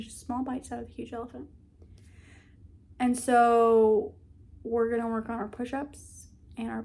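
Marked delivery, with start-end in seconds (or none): none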